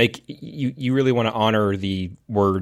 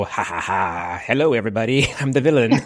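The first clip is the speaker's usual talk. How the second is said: hammed-up villain; 2 semitones higher than usual. Podcast host spoofs a mystery narrator revealing the bad guy, hammed-up villain. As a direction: playfully give away the bad guy. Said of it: "use the voice early on and go".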